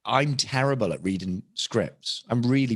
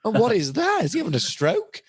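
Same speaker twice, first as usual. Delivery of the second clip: higher-pitched mock horror; incredulous, pitched-up exclamation; appalled disbelief; 7 semitones higher than usual. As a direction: react aghast to your own awful work. Acted horror at his old impression.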